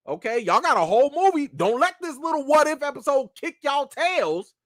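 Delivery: rally the crew to step it up; imitating Kevin Feige. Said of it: "Kevin Feige himself even told everybody".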